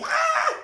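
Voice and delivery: high, loud horror-movie scream. Shown as a demonstration. Moviegoer frightened by a horror film, a loud scream. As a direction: let out a short fright scream. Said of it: "people scream like".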